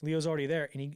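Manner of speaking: ordinary talk, nothing marked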